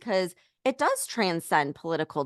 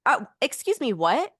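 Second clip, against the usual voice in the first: mock indignant; 6 semitones higher than usual. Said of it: "And it's like".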